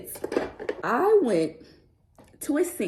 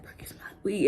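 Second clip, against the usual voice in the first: whisper; 8 dB quieter, faster than usual. Retold or imitated. Retelling the girls' warning.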